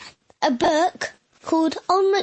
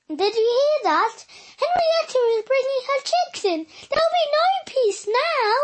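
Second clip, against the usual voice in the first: higher, fretful complaining voice; grumbling sheep; 8 semitones higher than usual. Acted. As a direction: complain to the other animals, voice up high. Sheep grumbling about chicks.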